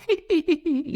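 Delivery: spooky giggle. Shown as a demonstration. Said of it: "suara orang ketawa".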